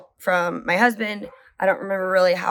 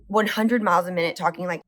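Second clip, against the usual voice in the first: usual speaking voice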